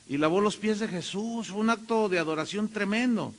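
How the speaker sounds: ordinary talk, nothing marked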